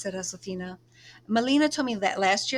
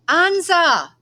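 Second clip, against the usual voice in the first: loud long call across the fields, much higher; 10 semitones higher, 14 dB louder than usual. Acted. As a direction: call after her from far away. Miguel calling her again.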